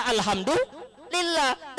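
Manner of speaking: high, slow, drawn-out alhamdulillah; teasing a wife's resigned thanks; mock sigh of thanks. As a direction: stretch it high and long in resignation. Wife mocking husband's empty income.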